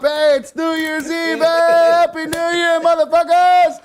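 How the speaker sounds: high, loud New Year's yell; celebratory shout. Real, not acted. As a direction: cheer at the top of your lungs.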